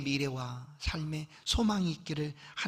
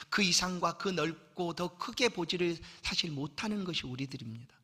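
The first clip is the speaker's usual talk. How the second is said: ordinary talk, nothing marked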